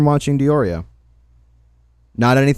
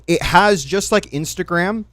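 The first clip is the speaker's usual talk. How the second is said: ordinary delivery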